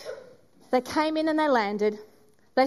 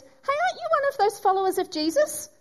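raised high, prodding question; accusing bystander; 9 semitones higher, faster than usual. Acted. Second bystander quizzing Peter, high suspicious prod. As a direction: needle him with a suspicious question.

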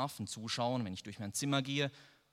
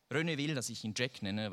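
ordinary delivery, nothing marked

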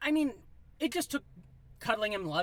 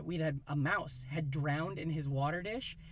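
ordinary delivery

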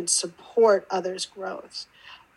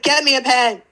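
louder, pressing repeat of the demand; insistent demand; 4 semitones higher, 6 dB louder than usual. Retold or imitated.

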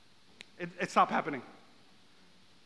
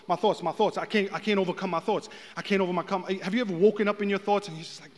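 ordinary talk

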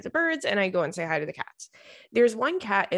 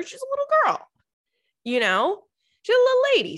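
high, louder cooing; 4 dB louder, faster than usual. Retold or imitated. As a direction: coo like at a small child. Cooing about the girl.

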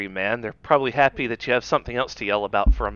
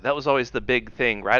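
ordinary talk

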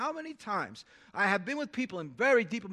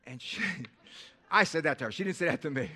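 normal talking voice

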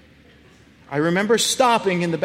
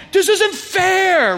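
put-on loud, high, drawn-out kid outburst; mock tantrum, stretched out and much higher; mad; 12 semitones higher, 5 dB louder, much slower than usual. Angry twelve-year-old protests to his dad, high loud mock outrage. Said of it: "I'm starting to get mad".

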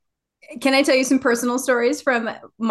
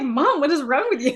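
laughing son; 6 semitones higher than usual. Retold or imitated. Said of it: "He was like".

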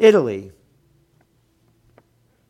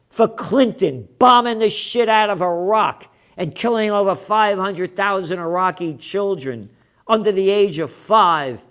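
loud, high, indignant rant; angry, emphatic accusation; outraged challenge; 6 semitones higher, 7 dB louder than usual. Real, not acted. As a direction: shout the demand at the court. Challenging The Hague about war crimes.

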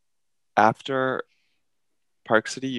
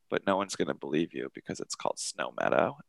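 low teasing jab; 7 semitones lower, 10 dB quieter than usual. Retold or imitated. Friend taunts her at bottom.

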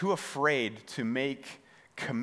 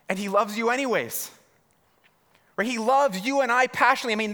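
higher and louder, driving sermon voice; impassioned preaching; 8 semitones higher, 4 dB louder than usual. Acted. Pastor tells the congregation Jesus loves failures, impassioned. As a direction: preach it hard to the room.

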